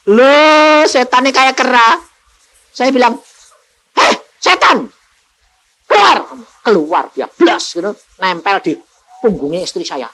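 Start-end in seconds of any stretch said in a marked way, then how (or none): 3.9-6.2 higher, sharp order to leave; preacher ordering the demon out; commanding the demon. Retold or imitated. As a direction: cast the devil out with a raised voice.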